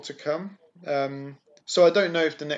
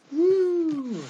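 high excited whoop; cheer; 14 semitones higher, 4 dB louder than usual. Real, not acted. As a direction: whoop with delight. Cheering the award.

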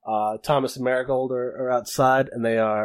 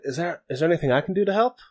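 put-on Australian accent; 6 semitones higher, faster than usual. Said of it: "he has to have that accent from now on".

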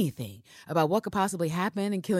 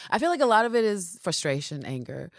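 ordinary talk

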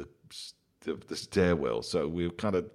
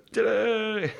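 much higher showy reveal cry; mock ta-da; 14 semitones higher than usual. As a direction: jump out like a surprise act. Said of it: "appropriate to go".

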